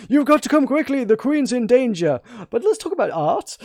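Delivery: high, loud mock panic that fizzles into chit-chat; exaggerated alarm parroting the game's line; mock frantic servant. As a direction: ham up the urgent servant's line, then drop into small talk. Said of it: "smushed two conversations together".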